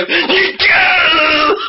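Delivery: long high shout of a name; gleeful call. Acted. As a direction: stretch the name out, high and laughing. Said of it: "they go".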